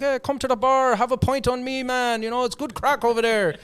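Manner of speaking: loud, high-pitched Irish pub invitation; Irish accent. Retold or imitated. Irish guy invites friend for a pint, Irish accent. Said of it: "he's this Irish guy".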